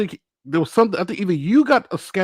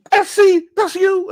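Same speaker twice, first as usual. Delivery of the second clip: high, loud excited cop; thrilled cop greeting a star; cop's voice; 7 dB louder, slower than usual. Retold or imitated. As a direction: play the cop who recognizes him.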